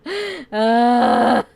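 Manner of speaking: big burst of laughter; loud laugh. Real, not acted.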